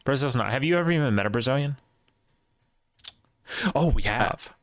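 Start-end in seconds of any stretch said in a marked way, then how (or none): none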